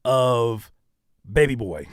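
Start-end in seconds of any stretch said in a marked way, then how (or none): none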